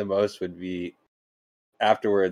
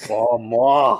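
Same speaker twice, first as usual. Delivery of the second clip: mock French woman; 3 semitones higher, 6 dB louder than usual. Mimicking French woman moaning.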